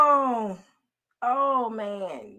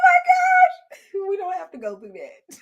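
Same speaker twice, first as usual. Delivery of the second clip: laughing cry; 10 semitones higher, 5 dB louder than usual. Real, not acted. Laughing at work story.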